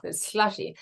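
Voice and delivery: lateral lisp on the S of slushy. Shown as a demonstration. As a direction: air escaping past the side of the tongue on S. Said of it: "like that".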